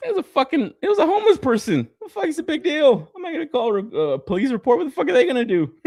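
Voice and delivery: high, shrugging mock reasoning; retelling his own thoughts, high and dismissive; retold inner monologue. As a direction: talk yourself out of caring. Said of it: "Then I thought to myself".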